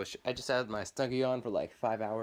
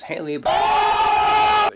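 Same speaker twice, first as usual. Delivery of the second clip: sudden shriek; 16 dB louder, much slower than usual. Real, not acted. Mocking friend Haley.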